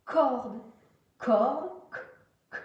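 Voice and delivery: corde said slowly, then its hard k alone; sounded-out first consonant. Shown as a demonstration. Teacher lets pupils hear the first sound, corde broken down to a bare k. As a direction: isolate the opening k of corde as a crisp click. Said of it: "est-ce que dans corde, on entend le".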